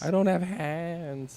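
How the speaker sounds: high, drawn-out mock whine; whining kid. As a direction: whine like a child dodging a chore. Acting kids refusing to help.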